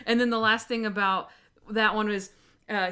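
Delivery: ordinary delivery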